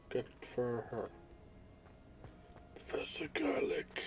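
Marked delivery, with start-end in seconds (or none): 2.9-4.0 whispering. Pretend. Whispered name after letter.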